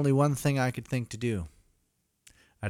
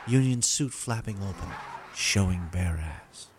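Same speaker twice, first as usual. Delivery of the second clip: rhythmic storybook poem recital; lilting irish storyteller; 3 semitones higher, 5 dB louder than usual. Acted. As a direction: read it like a Christmas poem. Night Before Christmas parody narration.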